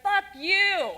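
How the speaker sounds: half-acted angry outburst; mock road-rage yell. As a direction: yell it like you got cut off in traffic. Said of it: "want to scream and yell and be like".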